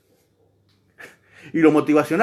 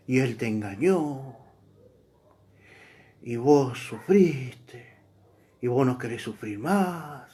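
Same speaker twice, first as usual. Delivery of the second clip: softer, slower, syrupy telenovela preacher; mock telenovela tone, softer and drawn out; soap opera voice; 4 semitones lower, 4 dB quieter than usual. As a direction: imitate a soap-opera preacher lulling the crowd. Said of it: "pones esa voz de telenovela".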